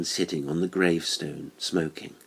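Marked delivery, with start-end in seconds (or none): none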